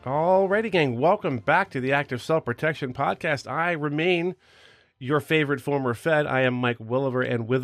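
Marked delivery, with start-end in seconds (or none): none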